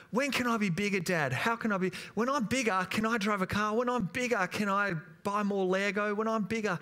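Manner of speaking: small son nagging his dad about getting bigger; eager little boy. Retold or imitated. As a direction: beg like a kid desperate to grow up. Son pleading to grow up.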